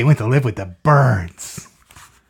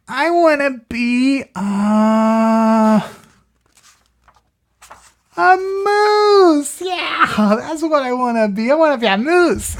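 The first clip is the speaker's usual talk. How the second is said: high, loud moose cry; excited high voice; 12 semitones higher, 5 dB louder, slower than usual. Acted. PJ decides on the moose, high and loud.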